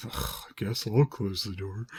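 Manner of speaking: soft put-upon groan; mock weary resignation; reluctant grumble. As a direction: grudgingly give in with an "ugh". Voices Mel's reluctant grumble.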